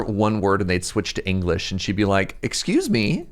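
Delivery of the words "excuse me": high-pitched offended interjection; mock indignation. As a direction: imitate an affronted woman, pitched up. Acts out offended Quebecois woman.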